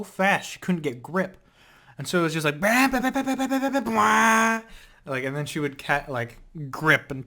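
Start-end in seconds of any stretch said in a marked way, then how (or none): 2.6-4.6 high, rapid bah bah sound effect; spinning-paws noise. Retold or imitated. Vlogger imitating the dog's legs spinning out on the floor, high mock noise. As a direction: mimic legs scrabbling in place on a waxed floor.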